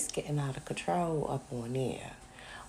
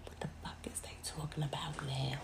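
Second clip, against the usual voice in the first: whispering. Real, not acted. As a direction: keep it hushed to the mic. Speaking in low whisper.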